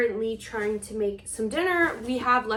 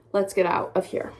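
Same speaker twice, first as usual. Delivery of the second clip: normal talking voice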